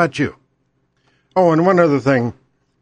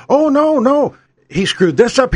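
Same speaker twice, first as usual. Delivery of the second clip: higher-pitched protest at the start, then settling; mock indignant candidate; 6 semitones higher than usual. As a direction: play a candidate objecting firmly. Acts imagined candidate's indignant answer.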